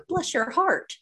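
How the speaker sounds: sugary high Southern bless your heart; mock-polite Southern lady; mock sweet Southern. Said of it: "it's just a kind way of saying".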